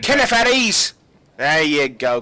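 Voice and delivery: loud, high command at one man; sharp captain's order. Acted. Captain barking at Kenneth to stand at ease, loud. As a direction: snap the name, then the order.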